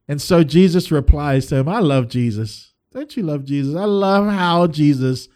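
ordinary delivery

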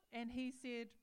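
ordinary delivery

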